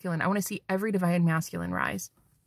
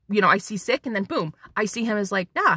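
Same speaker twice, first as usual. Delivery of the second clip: normal talking voice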